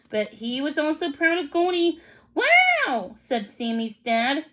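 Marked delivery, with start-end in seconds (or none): 2.3-3.1 high, louder amazed wow; impressed wow. A narrator's reading. Dad impressed by the dog, high amazed wow. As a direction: let it burst out in surprise.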